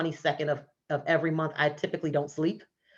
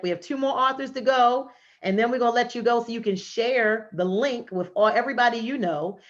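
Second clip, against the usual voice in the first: higher, louder, bright announcing voice; upbeat emcee; 6 semitones higher, 6 dB louder than usual. Real, not acted. Host introducing the next author to the stage, upbeat and louder.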